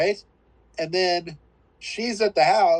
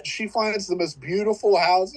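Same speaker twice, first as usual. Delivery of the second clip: usual speaking voice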